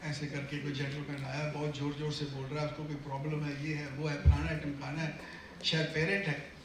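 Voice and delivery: lightly voiced receptionist, murmuring quickly; receptionist reporting. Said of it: "आपको आके कहती है कि".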